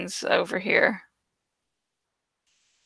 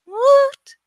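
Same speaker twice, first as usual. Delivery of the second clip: mock woot; 5 dB louder than usual. Real, not acted. Celebrating upcoming episode.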